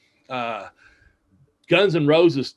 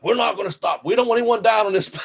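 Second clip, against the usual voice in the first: higher-pitched mock-serious insistence; dead serious; 9 semitones higher than usual. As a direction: imitate someone adamant, voice raised in pitch. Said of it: "he's all dead serious".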